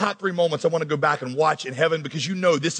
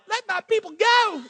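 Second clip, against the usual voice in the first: much higher, thin and timid; weak mousy voice; 13 semitones higher than usual. Shown as a demonstration. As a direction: squeak it out like a mousy stutterer. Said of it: "It sounded more like".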